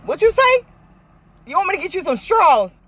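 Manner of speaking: loud, high-pitched retold questions; mock nosy questioning. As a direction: act out a pushy question, pitch flying up. Mimicking nosy gossip exchange with taunt.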